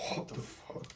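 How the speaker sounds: breathless, hushed disbelief; whispered amazement at the chart; stunned whisper. Real, not acted. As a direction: breathe it out in shock. Staring at sudden price jump.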